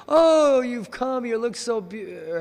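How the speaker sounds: loud high "oh" easing down; delighted greeting. Retold or imitated. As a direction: gush over arriving guests, starting high and loud. Krishna greeting the arriving gopis.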